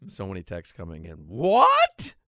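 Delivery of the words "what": high shouted what; surprised shout. Real, not acted. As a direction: yelp out in surprise. Exclaimed reaction to texts.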